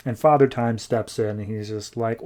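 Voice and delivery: usual speaking voice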